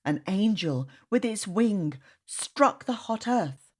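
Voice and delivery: plain reading voice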